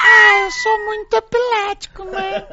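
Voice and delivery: shrieking high and laughing; hammed-up [name] impression. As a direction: camp squeal through laughter. Mocking the singer's quote. (imitação exagerada aos gritos, rindo)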